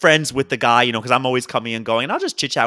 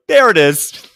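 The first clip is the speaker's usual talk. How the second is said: laughing aloud; 4 semitones higher, 9 dB louder, slower than usual. Retold or imitated. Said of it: "Out loud, I'm like".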